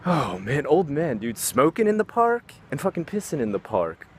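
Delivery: ordinary talk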